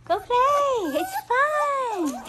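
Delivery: high, loud excited call; urging the puppies to play, high and loud; excited cheer. Real, not acted. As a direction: whoop them into playing.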